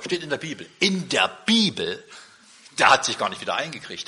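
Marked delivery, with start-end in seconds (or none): 0.8-2.0 slow, stunned question; disbelieving repeat of the words; incredulous echo. Retold or imitated. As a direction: visitor echoing the answer in disbelief. Visitor astonished at the answer.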